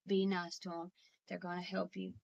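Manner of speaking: soft retold coaxing; tender, subdued reenactment; gentle reassurance. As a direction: quietly coax a sick parent to cooperate. Daughter gently reassures her dying father.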